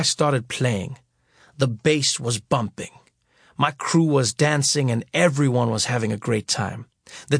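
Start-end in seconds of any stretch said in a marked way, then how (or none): none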